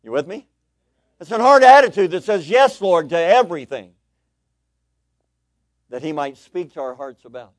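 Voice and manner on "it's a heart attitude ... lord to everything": high, loud pulpit declaration; raised, driving proclamation; forceful preaching. Real, not acted. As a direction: hammer it home at full voice. Preacher repeats key point forcefully.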